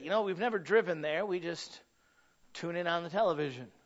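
ordinary talk, nothing marked

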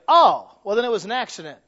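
suspect's sudden realization. Retold or imitated. Suspect blurts a confession.

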